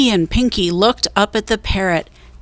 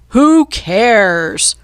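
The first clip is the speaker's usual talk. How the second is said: roared; 2 semitones higher, 4 dB louder than usual. A narrator's reading. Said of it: "roared Cap'n Bones".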